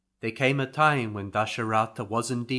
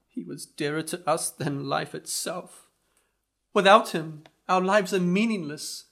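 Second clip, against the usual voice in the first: higher, firm grieving parents; 7 semitones higher, 5 dB louder than usual. Acted. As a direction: insist on joining their son. Narrator voices parents refusing.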